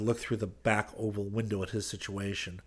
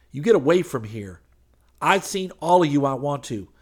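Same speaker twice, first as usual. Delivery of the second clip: raised, higher voice, sending him off; angry dismissal; 5 semitones higher, 7 dB louder than usual. A narrator's reading. Hayes ordering the preacher away from his car, angry.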